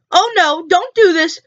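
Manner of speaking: ordinary delivery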